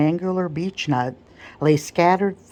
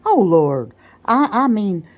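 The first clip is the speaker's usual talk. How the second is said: mock alarm; 5 semitones higher, 5 dB louder than usual. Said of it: "catching his breath with a ludicrous flourish of apprehension".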